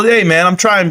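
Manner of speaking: ordinary talk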